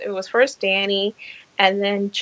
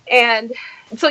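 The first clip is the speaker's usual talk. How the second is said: normal talking voice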